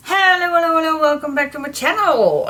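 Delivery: high, loud and bubbly hello; excited greeting. Real, not acted. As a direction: greet viewers with big energy. Cheerful vlog intro greeting.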